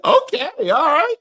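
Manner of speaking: high-pitched whooping okays with laughter; excited teasing. Real, not acted. Host reacting to the mention of Maxwell, high excited teasing. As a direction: hoot it high, egging them on.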